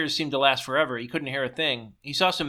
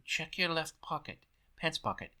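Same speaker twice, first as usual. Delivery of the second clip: much softer voice murmuring instructions; demonic whisper in the ear; 9 dB quieter than usual. A narrator's reading. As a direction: coach him secretly from inside his ear. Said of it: "the demonic Jiminy Cricket voice in his ear said".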